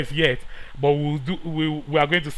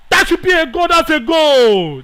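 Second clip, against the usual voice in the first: excited shout; 12 semitones higher, 9 dB louder than usual. Real, not acted. Gamer calling his team's goal, loud and excited. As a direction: call the goal as it goes in. Goal scored in football game.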